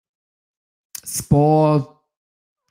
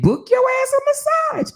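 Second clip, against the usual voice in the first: high punchline. Real, not acted. Telling viewers to book a massage, squealed high.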